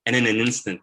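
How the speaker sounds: ordinary talk, nothing marked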